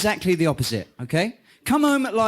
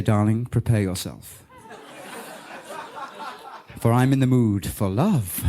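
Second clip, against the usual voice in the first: a hint of a lower seductive croon; 6 semitones lower than usual. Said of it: "in your most seductive voice".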